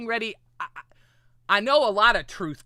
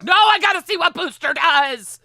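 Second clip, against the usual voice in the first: higher, louder, eager insistence; eager mind voice; 8 semitones higher, 8 dB louder than usual. Retold or imitated. Her mind refusing to sleep, eager and louder. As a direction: push it out keen and insistent. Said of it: "But my mind was like".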